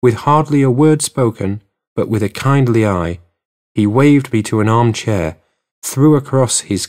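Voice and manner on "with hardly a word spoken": ordinary talk, nothing marked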